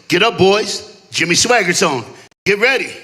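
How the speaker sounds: loud, firm fatherly order; acted dad, calling out loudly; dad's wake-up call. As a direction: rouse the kids with loud, short commands. Dad rousing the boys.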